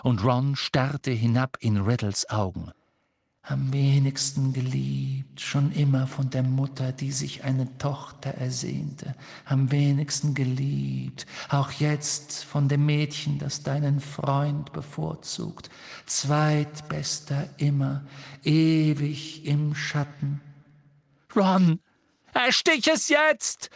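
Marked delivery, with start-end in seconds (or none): 3.5-20.4 slow, high, whispery temptation; eerie locket voice. Acted. Locket voice tempting Ron with his fears, slow whispery. 21.4-23.7 high loud yell; roaring. Acted. Harry bellowing at Ron to stab now, loud high yell. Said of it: "brüllte Harry".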